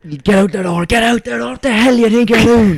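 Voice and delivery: high, loud angry yelling of a priest; imitated furious shout. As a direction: boom it like a priest who's lost it. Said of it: "He just grabbed it out".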